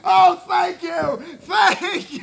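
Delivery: loud, high, slow thanks while laughing; a father on his knees thanking God; overjoyed father. Retold or imitated. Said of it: "He's like".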